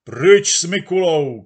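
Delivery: crowd yelling. Acted. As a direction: roar it as a hostile mob. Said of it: "křičel dav". (křik rozzlobeného davu)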